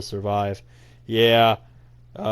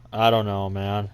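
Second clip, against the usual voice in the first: usual speaking voice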